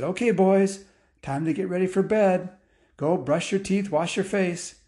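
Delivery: a hint of a mom calling bedtime. Mom calling kids to bed.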